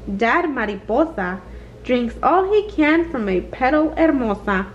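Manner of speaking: usual speaking voice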